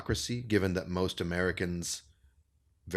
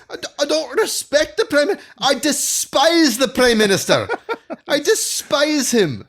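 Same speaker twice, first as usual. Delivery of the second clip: put-on much higher and louder Scottish accent, slowing into despise; George Galloway Scottish accent; 10 dB louder than usual. Galloway snapping back at a sneering journalist, loud Scottish accent. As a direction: impersonate Galloway with a booming Scottish accent, half laughing. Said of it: "you're doing a good Scottish accent".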